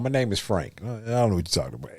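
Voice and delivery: put-on light Brooklyn accent, denying it. Ninja Turtle in a trench coat denying it, light Brooklyn accent. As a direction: play it as a Brooklyn guy saying his name is Frank. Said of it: "That damn Brooklyn accent".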